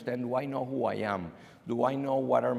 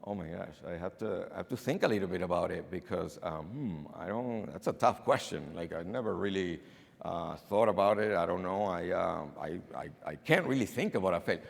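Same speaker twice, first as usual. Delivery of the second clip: low, halting stand-in for a stuck interviewee; acting out a candidate searching for an answer; stumped, hesitant; 6 semitones lower than usual. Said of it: "the whole thing stops".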